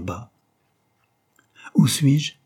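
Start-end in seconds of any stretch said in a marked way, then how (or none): 1.7-2.3 cri soudain. A narrator's reading. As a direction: question lancée haut et fort. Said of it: "cria une voix qui les fit tressaillir". (cri égaré, voix haute et forte)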